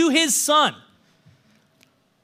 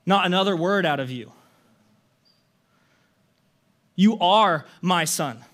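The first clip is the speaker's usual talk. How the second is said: tender father; 5 semitones lower than usual. Retold or imitated. Father interrupting son.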